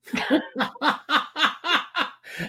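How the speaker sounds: breaking into giggles; can't stop laughing; helpless laughter. Real, not acted. Host cracks up at co-host.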